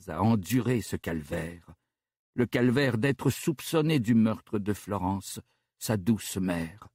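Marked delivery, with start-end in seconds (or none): none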